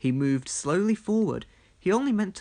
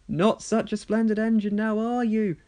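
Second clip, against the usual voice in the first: teasing; 7 semitones higher, 4 dB louder than usual. Acted. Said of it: "teased a workman".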